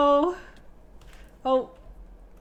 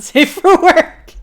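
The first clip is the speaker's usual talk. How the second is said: laughing; 7 semitones higher, 15 dB louder, much slower than usual. Real, not acted. Laughing at the innuendo.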